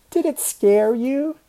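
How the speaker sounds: high, loud taunting question; crowing, drawn-up question; gleeful teasing. Acted. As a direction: tease the friend just frightened. Teasing after the ghost scare.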